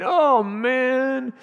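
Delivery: whining moan. Acted. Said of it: "he's whining and moaning".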